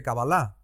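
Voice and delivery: "Kabbalah" stressed on the last syllable, ka-ba-LA; Hebrew pronunciation. Shown as a demonstration. Host citing how others say it, "Kabbalah" as ka-ba-LA. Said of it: "como se dice en hebreo".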